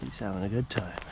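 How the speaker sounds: ordinary talk, nothing marked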